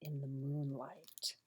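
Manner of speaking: breathy storybook whisper to begin; hushed whisper. A narrator's reading. Narrator opening the bedtime story in a whisper. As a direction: draw the child in, barely voiced.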